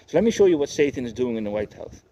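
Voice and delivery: normal talking voice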